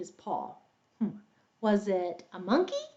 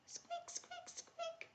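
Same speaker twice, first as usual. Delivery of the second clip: tiny, very soft high squeaks; mouse squeaks; 26 dB quieter, slower than usual. Pretend. Storyteller plays the tiny mouse for young listeners, faint squeaking.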